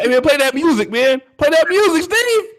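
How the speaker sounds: very high-pitched yelp; comic urgent demand. Real, not acted. Co-host demanding music to drown a caller, high comic yelp.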